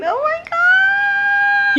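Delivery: very high, slow, drawn out oh my God; awestruck. Real, not acted. Wife shocked by the husband's second gift, awestruck. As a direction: stretch it in disbelief.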